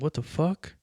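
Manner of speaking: mildly startled, pitch jumping up at the end; startled friend. Retold or imitated. Friends open the door to find him with his bags, startled.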